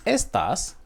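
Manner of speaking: estas with the first syllable stressed. Shown as a demonstration. Teacher contrasting Spanish words, estas stressed on ES. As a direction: stress the ES of estas, let the ending drop. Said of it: "pronunciate differently. For example".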